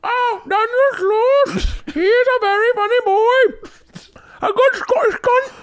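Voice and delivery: very high, squeaky bad Scottish woman; hammed-up Scottish falsetto; female Scottish accent. Scottish chick gushing about a comedian, squeaky Scottish accent. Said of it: "There's Scottish".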